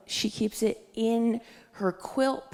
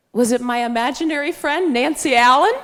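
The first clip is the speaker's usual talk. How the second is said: higher, louder mock mom, rising; mom guessing hopefully; 4 semitones higher, 8 dB louder than usual. Mom guesses at security question, high rising mock guess. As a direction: guess loudly, climbing to a questioning end. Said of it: "my mom's response to that was".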